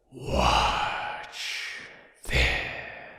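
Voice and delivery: low whispered boast; sly Wind whisper. Acted. Wind showing off to Sun, whispered. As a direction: whisper it like a gust about to blow. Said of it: "said Wind".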